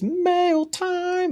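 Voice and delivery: high sing-song mail time; Blue's Clues call. Retold or imitated. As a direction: call it out like kids' TV. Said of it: "remember, like, Blue's Clues".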